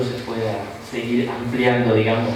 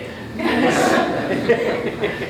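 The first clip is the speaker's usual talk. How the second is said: laugh; 8 semitones higher than usual. Real, not acted. Joking about group growth.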